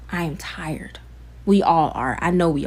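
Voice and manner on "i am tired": whispered I am tired, breath only. Real, not acted. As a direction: let the words fall to a weary whisper. Repeated line, whispered.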